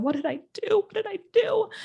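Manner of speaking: distraught, pitch climbing high; retold sobbing question, rising to a wail; panicked crying. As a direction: cry it out, voice breaking upward. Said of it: "I was bawling".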